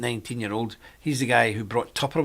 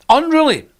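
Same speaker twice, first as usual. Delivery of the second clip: "unruly" jumped up high and loud; stress on unruly; 13 semitones higher, 9 dB louder than usual. Real, not acted. To the audience about Brits, with "unruly" hit high and loud. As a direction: hit "unruly" hard, near a shout.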